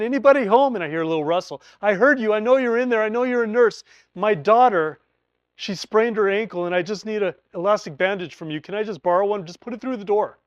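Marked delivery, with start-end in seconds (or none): 0.0-0.7 calling out, higher and louder. Retold or imitated. Knocking again, calling louder. 1.8-10.4 pleading call. Retold or imitated. Pleading through the door.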